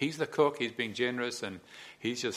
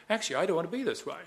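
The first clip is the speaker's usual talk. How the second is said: eager resolve; 8 semitones higher, 4 dB louder, faster than usual. Retold or imitated. Next thought, eager.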